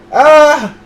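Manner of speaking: short loud scream; startled scream. Acted. Startled scream as rats swarm out. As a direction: yelp at the rats.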